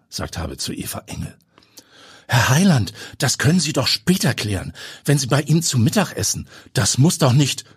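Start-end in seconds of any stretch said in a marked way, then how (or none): 2.3-7.6 higher, pleading protest; Eva protesting as she is shoved into the plane. Acted. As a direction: object before he pushes you in. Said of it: "protestiert Eva Engel".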